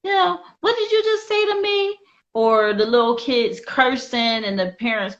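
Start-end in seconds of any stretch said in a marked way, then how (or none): 0.0-2.0 higher, lightly mocked amused parent; put-on high-pitched parent voice; laughing parent. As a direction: imitate a parent tickled by a child's back-talk. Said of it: "they're just laughing like".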